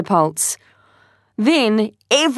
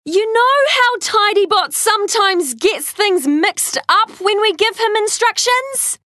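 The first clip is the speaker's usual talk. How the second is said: put-on child voice; 13 semitones higher than usual. Tai talks over noise.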